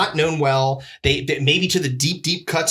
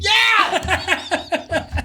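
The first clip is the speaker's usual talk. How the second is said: high, drawn-out yell; exasperated shout; 13 semitones higher than usual. Real, not acted. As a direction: yell it in comic frustration. Exasperated shout answering question about wife.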